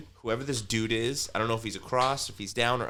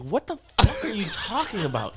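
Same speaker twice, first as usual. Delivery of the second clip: high, louder incredulous outburst; 12 semitones higher, 5 dB louder than usual. Retold or imitated. Answering the ranting neighbor, high incredulous outburst. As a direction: blurt it in disbelief. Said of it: "I'm like".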